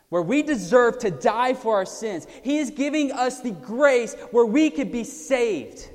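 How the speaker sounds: loud, high, driving sermon; preaching at full force. Real, not acted. Preacher proclaiming grace to the congregation, loud and driving. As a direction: preach it out with fire.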